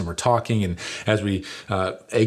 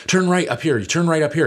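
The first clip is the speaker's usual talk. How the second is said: higher, louder confident call; calling out directions; 8 semitones higher, 4 dB louder than usual. Retold or imitated. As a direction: shout directions from the back seat. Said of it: "a voice from the back says".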